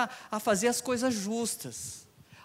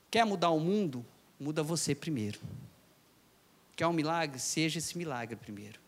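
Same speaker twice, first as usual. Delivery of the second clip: much lower and softer, inward; softer preaching; 6 semitones lower, 8 dB quieter than usual. Acted. Preacher quietly setting up the line for the congregation, soft. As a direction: say it gently before the call.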